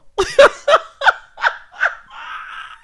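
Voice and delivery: very high, loud real laughter; howling cackle; shrieking laugh. Real, not acted. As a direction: scream with laughter. Cracking up at own impression.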